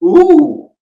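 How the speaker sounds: high, loud ooh of delight. Retold or imitated. On the fortieth take for the director, a high loud ooh. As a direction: break the pattern with a big high ooh. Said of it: "at the fortieth take, I go".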